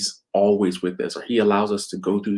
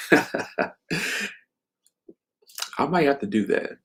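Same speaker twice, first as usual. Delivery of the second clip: amused musing; 6 semitones higher than usual. Real, not acted. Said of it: "turn this into a book".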